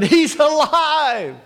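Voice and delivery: high, stretched-out cry; joyful shout. Retold or imitated. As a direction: shout the news in a high, ringing voice. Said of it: "when Mary shouted".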